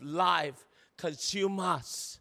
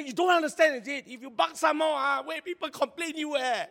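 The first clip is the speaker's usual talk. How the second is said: scolding the dog; 6 semitones higher, 3 dB louder than usual. Retold or imitated.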